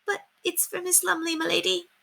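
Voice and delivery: soft, high timid squeak. Acted. Timid maid squeaks that the letter isn't hers. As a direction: squeak it out meekly. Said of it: "Margaret squeaked".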